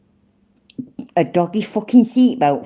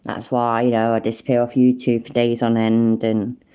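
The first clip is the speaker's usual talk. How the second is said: ordinary talk, nothing marked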